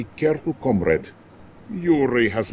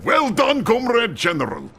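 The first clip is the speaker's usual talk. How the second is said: hearty praise; 10 semitones higher, 3 dB louder than usual. Acted. Premier praises general.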